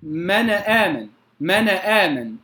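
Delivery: من آمن with a stray vowel, mana aaman; moved noon. Shown as a demonstration. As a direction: wrongly bounce the noon of من before آمن. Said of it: "لا أقول". (تحريك نون من آمن خطأً)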